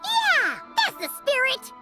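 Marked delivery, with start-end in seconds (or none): 0.0-0.6 high, eager shout of agreement; excited cheer. Acted. Cheering on a speaker's advice.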